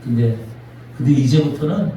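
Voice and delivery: low, soft and gentle; Jesus gently reassuring Peter, low and soft; tender comfort. Acted. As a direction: speak like consoling a broken friend.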